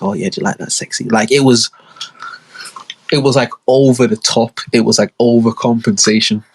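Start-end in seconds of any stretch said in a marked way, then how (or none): 0.0-1.0 soft teasing flirt. Retold or imitated. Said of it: "she'd always call me like".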